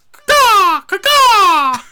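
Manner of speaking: shrill, very loud crow caw; crow call. Pretend. Hammed-up crow call summoning Al into the room.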